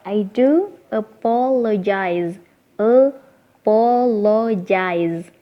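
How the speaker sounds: "apologize" slowly, syllable by syllable, g said as j; pelafalan per suku kata. Shown as a demonstration. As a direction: sound out "apologize" as a-po-lo-jaiz, very slowly. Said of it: "G-nya dibaca J". ("apologize" dieja pelan per suku kata, g dibaca j)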